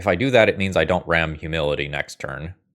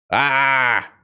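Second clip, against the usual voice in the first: loud, high cry of frustration; frustrated yell; 8 semitones higher, 6 dB louder than usual. Real, not acted. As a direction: let out an exasperated "Ah!". Frustrated at losing line in game.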